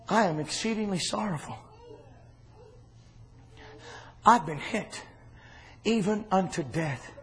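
low, soft sorrowful voice as Jesus; hushed sorrow. Acted. Jesus quietly telling his disciples of his sorrow, hushed and sorrowful. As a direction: murmur it like a man near death.

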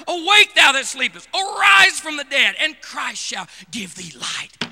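loud, ringing reading of the verse; thundering scripture reading; proclaimed scripture. Acted. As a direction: declaim the verse to the church.

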